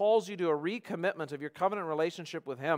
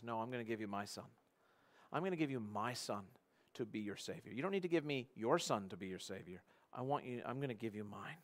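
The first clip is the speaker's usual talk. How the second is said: soft, unhurried reassurance; calm, tender reply; 3 semitones lower, 8 dB quieter than usual. Acted. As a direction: answer the outburst gently and quietly. God's calm answer to the outburst.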